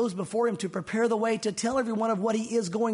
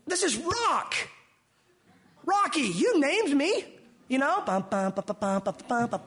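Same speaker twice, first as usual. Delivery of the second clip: higher, slowed, swaggering Peter boast; boastful Peter; 6 semitones higher, slower than usual. Retold or imitated. Peter boasting to the Lord in a swaggering voice. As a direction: ham up a cocky Peter bragging. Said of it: "Peter said".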